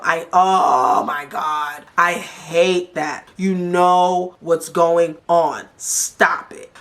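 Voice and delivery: slow, drawn-out exasperation; exasperated. Real, not acted. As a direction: drag out each word, fed up. Host exasperated at playing dumb.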